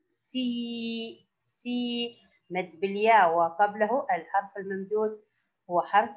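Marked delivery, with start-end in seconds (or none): 0.1-2.2 سي held long on a steady, softer ee; long vowel. Shown as a demonstration. Teacher shows the long sound to pupils, سي drawn out softly. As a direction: stretch the ee in سي evenly. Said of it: "وبها صوت طويل".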